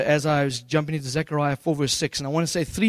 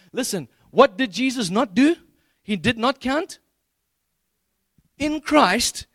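higher-pitched, measured pulpit delivery; emphatic scripture proclamation; 5 semitones higher than usual. Acted. Preacher tells congregation sins are not counted, emphatic proclamation. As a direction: proclaim it deliberately, pitch lifted on key words.

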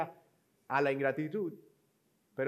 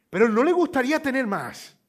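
high, loud, fast, exalted sales pitch; hammed-up thrilled saleswoman insisting; overexcited saleswoman; 8 semitones higher, 9 dB louder than usual. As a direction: imitate a telemarketer who can't believe you'd say no. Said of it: "exaltada, emocionadísima".

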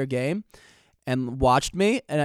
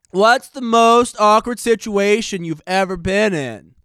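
put-on loud, higher, slow drawling teen; listener impression voice; 3 semitones higher, 5 dB louder, slower than usual. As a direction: read the letter in a put-on dude voice. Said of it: "it was a great impression, [name]".

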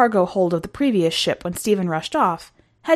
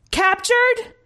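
very high, altered commander voice; incredulous commander; 14 semitones higher than usual. A narrator's reading. Commander questioning the returned soldier, incredulous. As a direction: throw it back as a high, disbelieving question.

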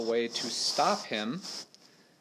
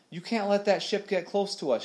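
put-on urgent girl; 9 semitones higher than usual. A narrator's reading. Molly pleading, voiced higher.

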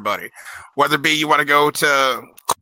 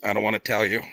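low, soft embarrassed mumble; ashamed, not wanting to admit it; sheepish; 7 semitones lower, 6 dB quieter than usual. Retold or imitated. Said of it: "and he goes".

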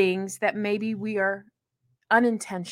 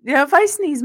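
ordinary delivery

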